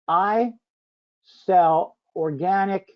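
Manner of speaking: slow, word-by-word dictation. Shown as a demonstration. Host dictating a prompt to the audience, slow and deliberate. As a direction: spell it out slowly while typing. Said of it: "I'm gonna get real specific here".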